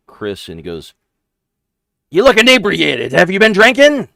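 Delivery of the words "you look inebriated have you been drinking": very high, loud goblin squeak; a small goblin sizing up strangers, shrill-pitched; squeaky goblin. Acted. GM voicing a goblin questioning the rogue's party, squeaky and high.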